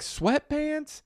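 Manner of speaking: high, incredulous question, drawn out; scandalized, high-pitched and slow; mock disbelief. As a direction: squeak it out, appalled at the very idea. Acting out disbelief at rude tourists.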